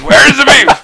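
startled shriek. Retold or imitated. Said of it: "she sort of jumps and says".

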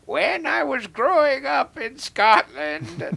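high, slow crackly old witch imitation; old Scottish witch. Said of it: "tilts his head ... starts saying".

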